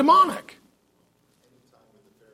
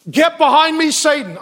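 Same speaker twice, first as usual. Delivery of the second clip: loud, higher commanding rebuke; forceful rebuke; 6 semitones higher, 4 dB louder than usual. Acted. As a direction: bark it like a lion. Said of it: "that was more lion-y".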